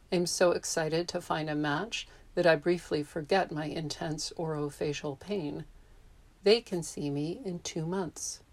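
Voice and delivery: ordinary delivery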